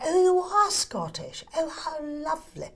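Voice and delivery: mock genteel English delight; a hint of a gushing Chichester lady; English accent impression. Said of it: "they would say things to me like".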